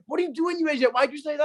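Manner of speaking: high-pitched scolding dad; exasperated dad. Retold or imitated. Dad tells off child over phone number, exasperated. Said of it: "my dad was like".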